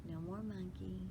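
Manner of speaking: ordinary delivery, nothing marked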